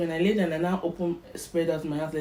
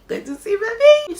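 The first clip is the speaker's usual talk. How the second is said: much higher, louder joyful squeal; thrilled; 11 dB louder than usual. Real, not acted. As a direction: burst out with joy. Excitement about baby.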